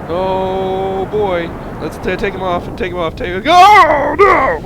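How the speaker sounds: high, loud panicked yelling as the rocket breaks apart; real alarm, voice shooting up; rising panic; panicked cry. Real, not acted. Rocket spinning out of control.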